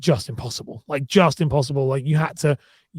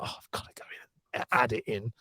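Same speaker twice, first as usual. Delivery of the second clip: breathy under-the-breath mutter, quieter; whispered grumble to self; 5 semitones lower, 10 dB quieter than usual. Retold or imitated. Editor whispering to himself about fixing subtitles, muttering. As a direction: sigh it out like a weary chore. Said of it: "So I'm like".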